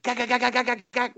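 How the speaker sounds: high, choppy rapid-fire gaks; imitated machine-gun fire. Nugent emptying the machine gun, imitated gunfire. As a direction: mimic automatic fire from the throat. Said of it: "with the M sixty".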